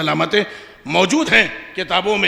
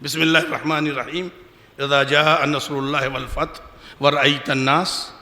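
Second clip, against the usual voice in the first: usual speaking voice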